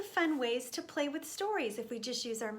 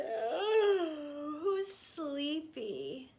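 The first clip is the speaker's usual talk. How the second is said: ordinary delivery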